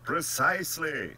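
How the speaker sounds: high, loud, pouncing agreement; emphatic agreement. Acted. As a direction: seize on the point with relish. General delightedly seizes on the objection.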